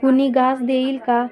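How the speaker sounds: narrator's restrained version of a beggar's call. A narrator's reading. Old beggar woman calls into a house for food, calling out mildly. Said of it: "तिनं आरोळी दिली".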